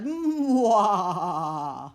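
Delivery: short villainous laugh, loud and hammed-up; evil cackle. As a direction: cackle like a cartoon villain in triumph. Evil laugh over gone underwear.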